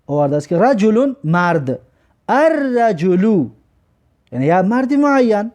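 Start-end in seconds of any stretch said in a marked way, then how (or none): 2.3-3.5 slow الرجلو said ar-rajulu, doubled r; lam silent. Shown as a demonstration. Teacher drilling students on the sun letter, silent lam. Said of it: "لام تلفظ نکردیم".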